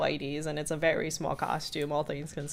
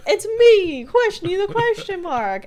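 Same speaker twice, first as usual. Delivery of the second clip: much higher and louder cartoon call; goofy Soos; 14 semitones higher, 11 dB louder than usual. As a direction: announce yourself like a proud mascot. Soos in costume calling out.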